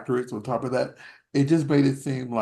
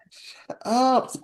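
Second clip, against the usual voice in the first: annoyed shush; 10 semitones higher than usual. Retold or imitated. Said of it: "you're just like".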